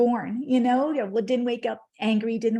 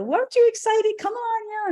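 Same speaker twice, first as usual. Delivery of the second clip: mock excited husband, much higher and louder; husband's eager voice; 13 semitones higher, 6 dB louder than usual. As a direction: play the husband pushing her, high and eager. Husband voiced, eager, in her retelling.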